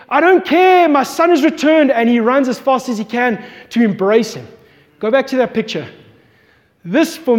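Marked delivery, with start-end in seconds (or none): 0.1-1.9 loud, much higher cry of joy; joyful father. Retold or imitated. Father defying decorum as he runs to his son, loud and elated. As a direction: throw off all decorum and shout it. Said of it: "this father said".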